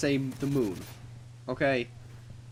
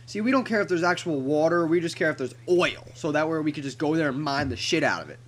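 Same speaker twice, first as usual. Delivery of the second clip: ordinary talk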